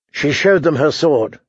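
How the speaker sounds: ordinary delivery, nothing marked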